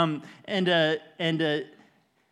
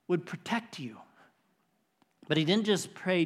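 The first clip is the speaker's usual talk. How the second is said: usual speaking voice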